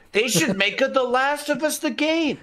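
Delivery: high, held-up silly announcement; mock pitch. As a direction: pitch a joke title in a high, sing-out voice. Jokingly pitching an absurd title.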